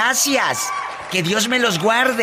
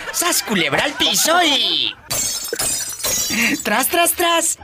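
rising, laughing high cheer; excited catchphrase; 8 semitones higher than usual. Acted. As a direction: whoop it out, climbing.

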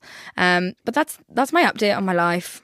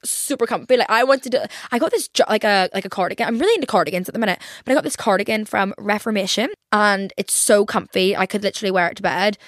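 ordinary talk

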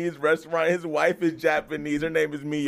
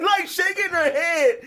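ordinary talk, nothing marked

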